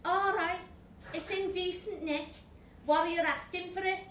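put-on brisk Liverpool accent haggling; Scouse accent. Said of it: "was a Scouser".

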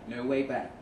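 usual speaking voice

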